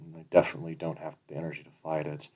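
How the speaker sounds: ordinary delivery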